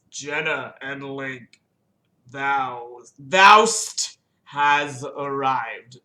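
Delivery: very slow, stately tree voice; ancient talking tree. Acted. Deku Tree wakes to greet visitors, slow and stately. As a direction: draw each word out like a waking old tree.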